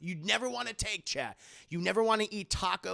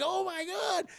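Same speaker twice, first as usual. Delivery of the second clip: very high drawn-out squeal; imitated horrified woman; mock horror; 14 semitones higher, slower than usual. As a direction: gasp it out in put-on dismay. Acting her horror at scan.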